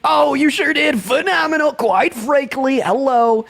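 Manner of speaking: high, theatrical showman cheer; hype announcer. Acted. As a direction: hail the answer like a show host. Host theatrically cheers a yes answer.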